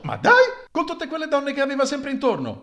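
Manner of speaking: very high, loud disbelief; scandalized disbelief. Acted. A gossiping lady scandalized by the rumor, very high and loud. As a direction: gasp at the news.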